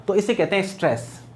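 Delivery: ordinary delivery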